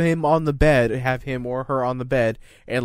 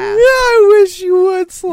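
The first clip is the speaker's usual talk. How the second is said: taunting shout; 9 dB louder than usual. Real, not acted. Mock fight banter.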